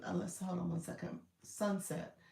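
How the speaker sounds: ordinary delivery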